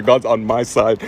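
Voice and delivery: low, slow, drawn-out mock gloat; smug gloating. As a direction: savor the victory slowly and low. Gloating after witness fails.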